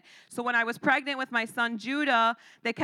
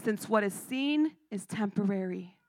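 hushed scripture; 3 semitones lower, 4 dB quieter than usual. Acted. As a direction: let the verse land gently.

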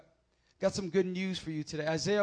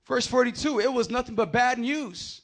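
from measured to soaring, louder and higher preaching; building sermon; 5 semitones higher, 7 dB louder than usual. Acted. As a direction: build the sermon up to full force.

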